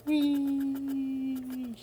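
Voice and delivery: high, gleeful whee; playful whee. Real, not acted. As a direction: squeal like on a slide. Playful cheer while beveling.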